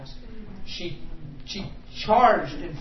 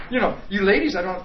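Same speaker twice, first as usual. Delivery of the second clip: ordinary talk, nothing marked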